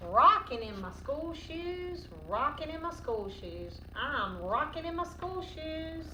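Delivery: ordinary delivery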